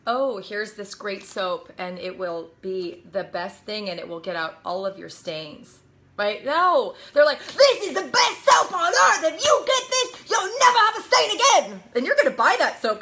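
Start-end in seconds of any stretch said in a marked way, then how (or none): 0.1-5.8 low, slow, soft mock infomercial; lifeless sales pitch. Speaker shows the audience a bad infomercial, unenthused. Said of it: "have you ever watched an infomercial". 7.4-11.8 much higher, hyped-up mock pitchman; high-energy infomercial pitch. Speaker mimics infomercial hosts selling soap, hyped up. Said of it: "people who are amazing with energy".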